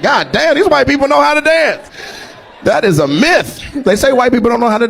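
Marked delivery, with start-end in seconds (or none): 0.0-1.9 high, impressed exclamation; mock amazed praise. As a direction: marvel at the dancers. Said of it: "I was like".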